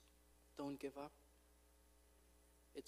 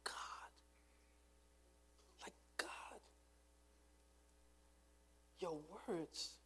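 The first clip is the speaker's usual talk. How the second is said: hushed whisper; 5 semitones higher, 8 dB quieter, slower than usual. Retold or imitated. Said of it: "and he said".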